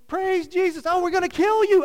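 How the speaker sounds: loud, high hammed-up yell; sweet words then a loud threat; mock angry parent. Parent yelling at family on way to church, hammed-up. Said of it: "we, we".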